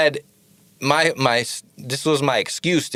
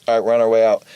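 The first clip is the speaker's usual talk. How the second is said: radio talk; 2 semitones lower, 3 dB louder, much faster than usual. Retold or imitated. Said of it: "Who are you talking to?".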